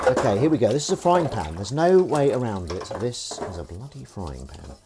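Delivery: ordinary talk, nothing marked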